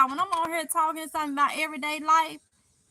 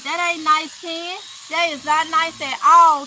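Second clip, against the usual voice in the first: mock scolding; 3 semitones higher, 7 dB louder than usual. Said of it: "I'm just playing".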